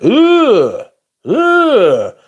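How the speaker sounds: loud, high retching ugh; disgusted ugh. Real, not acted. Streamer grossed out by a friend's snack, loud ugh. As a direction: groan out the disgust loudly.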